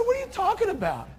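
high, baffled retort; scene: pastor answers his buddy, baffled. Retold or imitated. Surprised and confused. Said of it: "I said".

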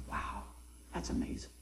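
whispered retold amazement; barely audible, awestruck; hushed awe. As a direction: breathe it out very softly in wonder.

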